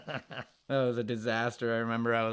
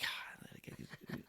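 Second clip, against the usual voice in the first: a hushed unvoiced line; whispered aside; 18 dB quieter than usual. Guard quietly turning Stan down, whispered. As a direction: whisper it like a secret.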